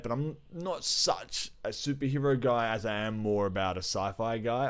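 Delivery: ordinary delivery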